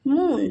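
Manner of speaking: मून with a long, held oo; long vowel. Shown as a demonstration. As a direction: draw out the oo in मून. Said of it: "हो गया long sound".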